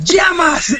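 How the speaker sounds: sharp high angry name call; stern teacher call. Retold or imitated. Teacher catching student's remark, sharp angry call. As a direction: snap the name like an outraged teacher.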